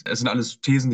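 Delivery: ordinary talk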